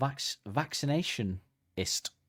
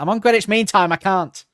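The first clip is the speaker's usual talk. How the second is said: high and much louder, a hammed-up protest; loud high mock sun; 9 semitones higher, 13 dB louder than usual. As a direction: shout the excuse like a huffy sun. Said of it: "he's like".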